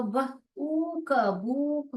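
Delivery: ब, ऊक, बूक with a long held oo; long vowel blend. Shown as a demonstration. Teacher showing wrong reading, long oo in बूक. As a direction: blend it slowly, stretching oo in बूक. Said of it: "अगर मैं इसको ऊ डाल रही हूँ".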